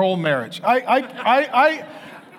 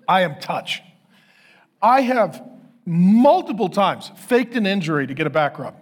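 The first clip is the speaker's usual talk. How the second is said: normal talking voice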